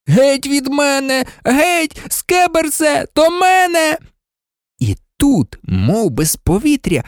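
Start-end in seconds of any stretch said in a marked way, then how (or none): 0.1-4.0 high-pitched frantic shouting; piercing cry. A narrator's reading. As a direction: cry out into the night. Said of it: "Крик розпанахав тихе нічне повітря". (пронизливий високий крик)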